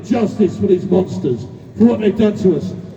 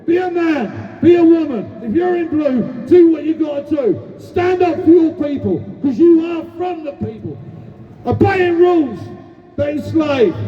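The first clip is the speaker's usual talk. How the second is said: rally shout; 4 semitones higher than usual. Real, not acted. Speaker rallying the crowd, calling on police to act, shouting. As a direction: roar it out to rouse the people.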